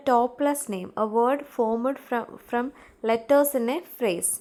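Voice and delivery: each word drawn out very slowly; teacher dictating, very slow; slow dictation. Shown as a demonstration. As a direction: read the definition at dictation speed. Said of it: "reads definition to class".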